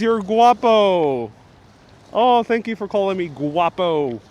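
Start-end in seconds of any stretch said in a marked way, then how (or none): 0.0-1.3 slow, drawn out. Retold or imitated. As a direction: read out slowly, every syllable stretched. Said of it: "Gigi says".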